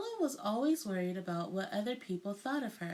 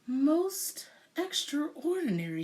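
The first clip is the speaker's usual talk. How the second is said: slow, higher, marvelling doctor; doctor marvelling at the stripes, slow and amazed; astonished exclaim; 6 semitones higher, 4 dB louder than usual. A narrator's reading. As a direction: draw it out in wonder. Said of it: "he exclaimed".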